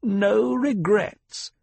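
high, slow, drawn-out sneer; mock her nobility with contempt; slow scornful old woman. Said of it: "the Reverend Mother sneered".

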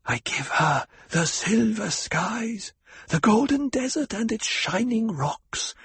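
high, slow, harsh crone whisper; old woman whispering. A narrator's reading. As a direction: bestow the gifts like an ancient priestess.

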